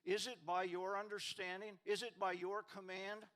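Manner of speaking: loud, driving rapid-fire questions; preaching at full force. Acted. Pastor voicing God's challenge to Job, booming preaching. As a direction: thunder each challenge like God.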